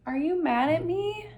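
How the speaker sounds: high, frightened blurt; anxious exclaim. A narrator's reading. Opal in the dark cave, high and frightened. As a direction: burst out, scared of the answer.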